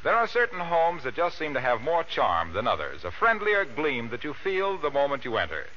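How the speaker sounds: usual speaking voice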